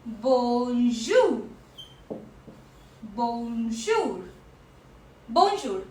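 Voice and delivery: bonjour said in French, bon-joor; French pronunciation. Shown as a demonstration. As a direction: model bonjour clearly for the class. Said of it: "The very first greeting is".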